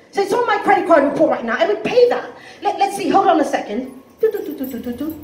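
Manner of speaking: put-on breezy British-accented stalling. British debtor pretending to pay by phone, British accent. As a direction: promise payment in a British accent, then hum the keypresses. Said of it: "he's got a British accent".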